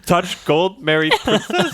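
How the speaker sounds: high, loud, broken caveman talk. Pretend. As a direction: grunt it like a caveman. Sums up moral in caveman voice.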